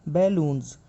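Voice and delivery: बैलून्स ending in z, baloonz; z sound at end. Shown as a demonstration. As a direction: say बैलून्स with a voiced z. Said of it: "जेड की sound देगा".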